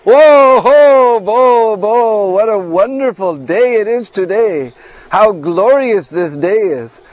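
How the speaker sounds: high, loud ecstatic cries of joy; overjoyed exclamation. Acted. Lalita overjoyed at the box.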